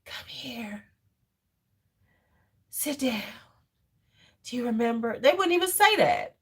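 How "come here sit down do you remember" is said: breathy whispered weak command; mock soft whispered order; limp whisper. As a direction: whisper it with no authority. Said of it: "That shit wasn't firm".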